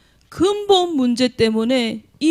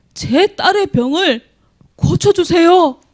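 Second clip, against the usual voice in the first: pleading; 6 semitones higher, 4 dB louder, slower than usual. Acted. Woman pleading to Jesus.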